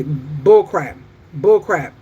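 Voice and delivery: loud, emphatic disgusted verdict; real exasperated outburst; upset. Real, not acted. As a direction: punch each word out, fed up. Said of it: "I'm so upset with this foolishness".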